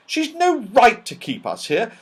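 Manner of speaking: put-on a touch of boyish protest; Joe, high and complaining. A narrator's reading.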